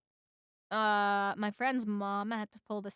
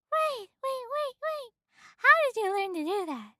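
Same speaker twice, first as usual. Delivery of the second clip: high-pitched, hurried woman; startled woman; 12 semitones higher than usual. A narrator's reading. Woman stops the bee leaving, urgently high. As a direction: call him back, pitched high.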